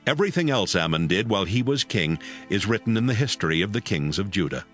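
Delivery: ordinary talk, nothing marked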